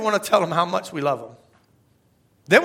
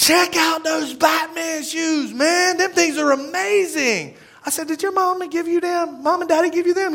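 high, slow, amazed over the shoes; excited praise; 10 semitones higher than usual. Retold or imitated. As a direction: gush to a kid about his Batman shoes. Enthusing to kid over shoes.

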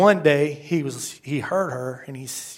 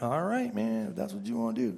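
soft, higher-pitched casual concession; hushed, laid-back "suit yourself" voice; God, easygoing; 5 semitones higher, 10 dB quieter than usual. Acted. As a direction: give in gently, letting them have their way. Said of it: "that's how he talks to me".

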